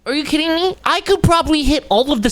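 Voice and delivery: squeaky high pitched rant; high mock opera voice. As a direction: go way up high like a singer. Mocking singer's high notes.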